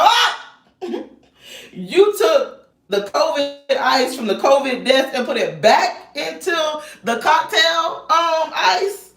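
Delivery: mimicking a baffled friend, high and slow; incredulous imitation. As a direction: play the shocked friend, drawn out.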